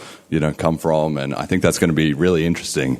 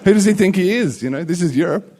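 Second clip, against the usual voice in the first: mock indignant waiter; 12 semitones higher, 3 dB louder than usual. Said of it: "trying to show everyone in the audience".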